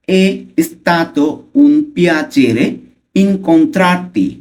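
slow, word by word with pauses; slow pronunciation. Shown as a demonstration. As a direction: stretch out each Italian word for learners. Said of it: "slowly উচ্চারণ করবো ফার্স্টে". (ধীরে ধীরে উচ্চারণ)

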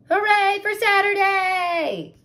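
high, loud cheer. Acted. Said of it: "they cheered".

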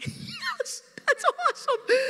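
a very high, laughing cheer; gleeful cheer. Retold or imitated. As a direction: cheer for the bad guy through a laugh. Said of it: "here's me. I'm like".

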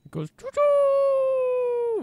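high, slow choo-choo call; train noise. Pretend. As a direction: sing out like a toy train. Imitates train sound.

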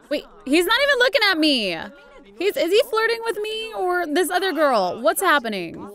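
outraged rush of questions; indignant surprise. Real, not acted. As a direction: sputter with disbelief at being ignored. Indignant at being ignored.

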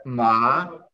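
MA stretched and gliding upward; second tone, a long rising MA. Shown as a demonstration. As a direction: let MA climb like a question.

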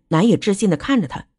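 usual speaking voice

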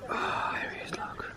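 hushed whisper; soft breathy aside. Real, not acted. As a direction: whisper it so as not to scare him. Spotting caterpillar, hushed aside.

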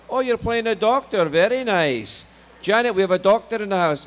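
high, loud, proud Scottish dad; thick Scottish accent. Retold or imitated. Dad boasting of doctor son, delighted Scottish. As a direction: crow to your wife in Scottish. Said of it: "my parents have a very thick Scottish accent".

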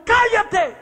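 sharp high shout of cállate; a preacher rebuking a witch doctor with a shout; shouted command. Retold or imitated. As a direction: bark it out to silence him. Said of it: "Y cuando le grito".

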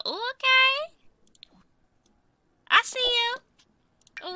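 high, slow sing-song i see you; sassy tease. Pretend. Player teasing baby Ava about her new outfit, high and drawn out.

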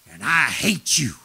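slow, low demonic hate, imitated; menacing low demon imitation; possessed girl. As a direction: drag out each word like a demon. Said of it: "she started manifesting".

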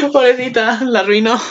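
high, laughing pobrecita; laughing pity. Real, not acted. Watching the anime, laughing pity for the girl. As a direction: giggle out the pity.

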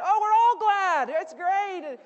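high, loud and slowed; cheering dad. Acted. Father celebrating at the loud party. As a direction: beam it out over the noise.

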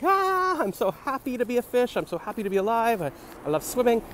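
very high, gushing fish voice; overjoyed fish. Pretend. The happiest fish raves about life before it is caught, very high. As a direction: start on a squeal and gush with joy.

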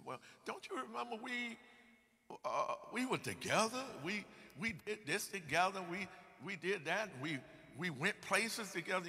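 put-on a pleading old friend, pushed up high; pleading reminder. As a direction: insist you shared it all with him, pitch up. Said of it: "You said".

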